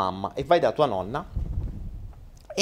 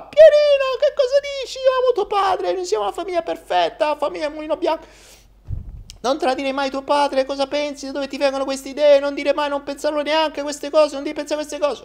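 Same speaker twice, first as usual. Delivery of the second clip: shrill indignant mother; 3 dB louder than usual. Acted.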